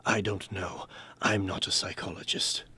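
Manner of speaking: normal talking voice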